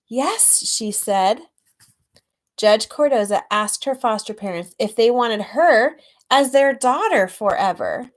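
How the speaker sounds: storybook reading; story narration. A narrator's reading. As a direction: read it like a picture book to a class. Reads picture book aloud.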